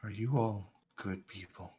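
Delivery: put-on quiet, hesitant old stranger; soft blind stranger. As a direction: speak softly like a wary blind man. NPC asks softly.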